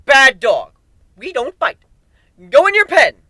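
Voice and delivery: high, loud, unhinged scolding; crazed owner telling off a puppy; manic scolding of a pet. Acted. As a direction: scold the monster like a naughty dog. Scolding monsters like pets.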